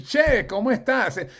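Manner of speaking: put-on lively Spanish with an Argentinian accent. Showing how ChatGPT greets in Argentinian Spanish, Argentinian accent. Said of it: "accent from Argentina".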